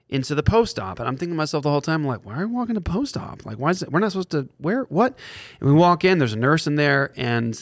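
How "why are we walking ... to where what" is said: high-pitched, muddled questioning; bewildered. Retold or imitated. Father walking into post-op by surprise, retold in high bewilderment. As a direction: imitate baffled inner panic, tumbling from question to question. Retelling his confused thoughts.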